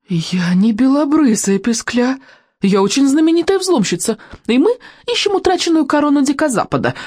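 high, indignant self-important protest; offended, chatty. A narrator's reading. Kamikadze answers the insult from Molnienos, offended. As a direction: draw out the first denial, then chatter on, huffy and high. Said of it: "ответила весьма обиженная, но по-прежнему болтливая Камикадзе".

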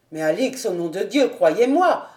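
impassioned exclamation in a male character voice; crying out. A narrator's reading. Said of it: "s'écria le capitaine".

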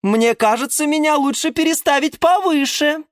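high-pitched plea; high, hopeful little plant voice; geranium character. A narrator's reading. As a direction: pipe up high to ask a favour. Geranium's pleading in story.

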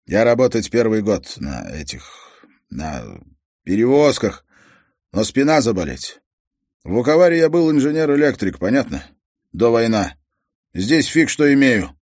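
loud, heavy-handed foreign father's speech; a proud immigrant father complaining. Acted. Croatian father tells the detective about his lost career, loud and blunt.

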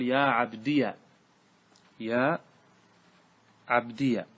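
"عبديا" said as ʿabdiya; short open -ya closing "عبديا", shown word by word; yā' with fatha. Shown as a demonstration. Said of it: "إثبات الياء وفتحها".

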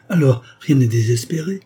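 a lawyer seizing a last chance, exclaiming; eager exclamation. Acted. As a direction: burst out with sudden hope. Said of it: "s'écria Maxou".

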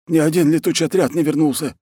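high-pitched distressed confession; panicked report. Acted. War chief confesses the lost raiders, high panicked voice. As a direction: blurt out bad news, high.

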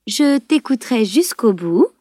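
slow and unbothered; calm voice. A narrator's reading. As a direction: answer him with slow, easy calm. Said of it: "lança-t-elle d'une voix tranquille".

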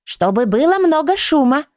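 high-voiced, businesslike little book. A narrator's reading. Leonila explains why noise is needed, businesslike. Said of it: "деловито произнесла Леонила".

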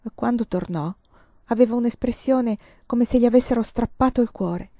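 plain reading voice

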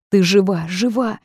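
frantic relief. A narrator's reading. Heroine hugging Natashka alive, frantic relief. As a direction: gasp it out, overcome that she lives. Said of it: "неистово шепча".

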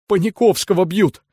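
high, desperate cry. A narrator's reading. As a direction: plead it in despair. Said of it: "с отчаянием повторил рыжий Шура".

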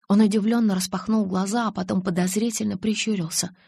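ordinary delivery

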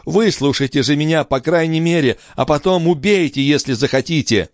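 high, urgent pleading; impassioned appeal; desperate cry. A narrator's reading. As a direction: insist on being heard. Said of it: "вскричал Жильбер".

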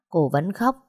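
usual speaking voice

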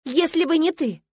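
high, loud, slowed accusation; shriek. A narrator's reading. Milena blames her rival for lost love, shrieking. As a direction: screech the blame, dragging the words. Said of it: "взвизгнула она".

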